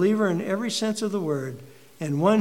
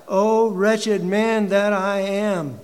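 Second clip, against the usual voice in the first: slow, loud, higher anguished lament; anguished cry; 4 semitones higher, 7 dB louder, much slower than usual. Acted. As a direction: wail it out in despair. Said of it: "He cries out".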